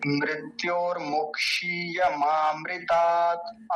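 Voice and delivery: मृत्योर्मुक्षीय मामृतात् drawn out syllable by syllable; slow recitation. Shown as a demonstration. Teacher modelling the correct chant, slow. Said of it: "बहुत गलत उच्चारण किया जाता है".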